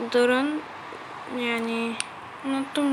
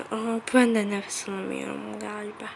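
ordinary talk, nothing marked

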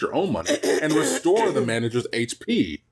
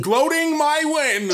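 high, slow, loud taunting voice; mocking; 5 dB louder, much slower than usual. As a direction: drag it out to mock her. Mocking sibling's words.

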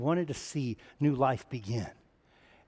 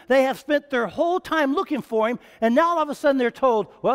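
high, loud, impassioned preaching; preaching at full force; 9 semitones higher, 7 dB louder than usual. Acted. Preacher pressing guilt on the church, loud and high. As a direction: build the fire of the sermon. Sermon climax building.

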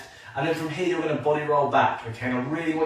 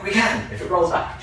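mock protest; 6 semitones higher than usual. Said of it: "I'm like".

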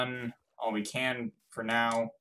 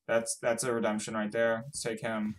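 ordinary delivery

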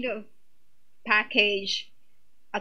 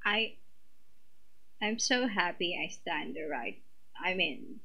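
much softer, lower, half to herself; overwhelmed hush; 4 semitones lower, 12 dB quieter than usual. Real, not acted. Fan overwhelmed after the video, soft and low. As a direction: murmur it, stunned and happy.

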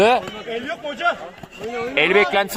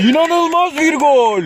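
screaming football commentator; 6 semitones higher, slower than usual. Acted. Goal call in match commentary.

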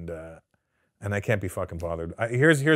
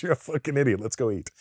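laughing; 3 semitones higher, faster than usual. Retold or imitated. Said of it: "And he goes".

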